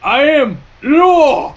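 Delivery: slow, high, drawn-out boast; mock grand declaration. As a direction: declare yourself the law, hammed up. Joke about being law.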